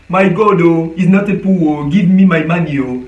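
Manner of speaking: imitating how Nigerians and Ghanaians speak English, mildly exaggerated; mock Nigerian English. Host mocking West African English speakers, in a playful Nigerian English accent. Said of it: "Oka ba anglais".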